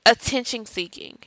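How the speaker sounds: normal talking voice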